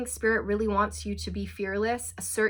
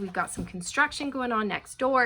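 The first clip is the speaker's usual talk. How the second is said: ordinary talk, nothing marked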